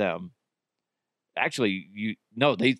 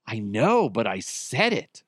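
ordinary talk

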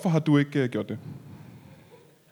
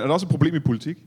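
ordinary talk

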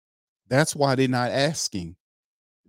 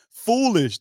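much higher, a triumphant verdict; gleeful shout; 10 semitones higher, 3 dB louder than usual. Real, not acted. Host crowning the quarterback with an insult, a high triumphant call. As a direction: crow the last insult. Final F word.

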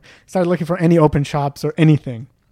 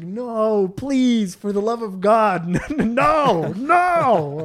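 pleading angry; 6 semitones higher, much slower than usual. Retold or imitated. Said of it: "Like, so angry".

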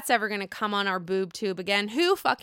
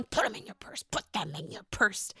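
low whispered movie-character impression; Uncle Frank; 3 semitones lower, 7 dB quieter than usual. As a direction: imitate a grumpy uncle in a hushed rasping whisper. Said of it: "Uncle Frank".

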